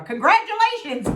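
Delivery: high, bright congratulations; mock congratulations. Imitating the husband praising her weight loss, sing-song and high. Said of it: "he never just says".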